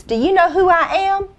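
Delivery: high, singled-out question; hospital visitor's greeting. Retold or imitated. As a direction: ask it high-pitched, as to a patient. Visitor checks patient recognizes her.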